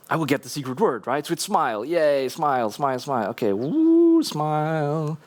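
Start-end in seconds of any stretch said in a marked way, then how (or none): none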